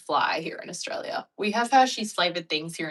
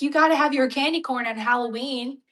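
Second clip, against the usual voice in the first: put-on American accent, higher; mock American; 7 semitones higher, 5 dB louder than usual. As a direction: parody an American candy corn fan. Voicing Americans about candy corn.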